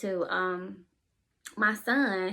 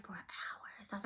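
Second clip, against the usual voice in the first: whispering; 17 dB quieter, faster than usual. Real, not acted. Whisper heard.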